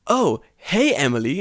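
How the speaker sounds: English lesson recording. Acted. Taku greeting Emily on a recording, mock textbook voice. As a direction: parody a textbook boy. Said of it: "And Taku's like".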